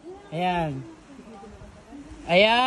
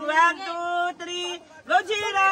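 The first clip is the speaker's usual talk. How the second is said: higher-pitched, cheering count; excited countdown; 6 semitones higher, slower than usual. Real, not acted. Counting a friend down to jump, excited call.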